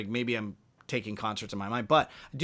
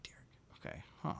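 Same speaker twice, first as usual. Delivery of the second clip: muttered to himself; 15 dB quieter than usual. Retold or imitated. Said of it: "And I was like".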